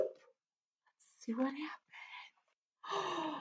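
slow, hushed stage whisper; suspenseful whisper. Acted. Storyteller to kids before the reveal, suspenseful whisper. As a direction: whisper as if peeking at a surprise.

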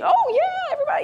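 mock bubbly teacher cheering everyone; encouraging. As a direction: cheer the whole class on. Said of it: "'Cause I was, like, encouraging".

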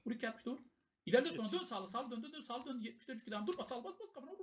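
high-pitched, rapid-fire battle calls; urgent commands. Real, not acted. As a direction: drive the team through the fight, pitch climbing. Raid leader urgently calling moves. (telaşlı, tiz sesle komut)

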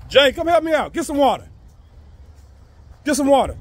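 loud, high call across the yard. Real, not acted. Dad calling his son over from across the yard, loud and high. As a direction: shout for your son to come help.

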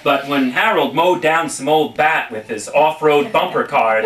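usual speaking voice

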